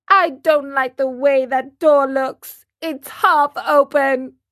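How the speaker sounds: put-on fussy child making excuses; lightly voiced petulant boy; whiny puppet boy. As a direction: complain like a balky marionette.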